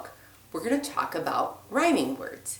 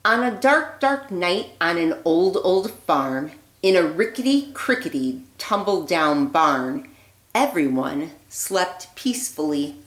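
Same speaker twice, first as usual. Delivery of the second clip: put-on lively read-aloud voice for children; storybook reading; 3 dB louder than usual. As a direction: read it like a bedtime story for little ones. Reads picture book aloud.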